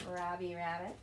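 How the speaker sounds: normal talking voice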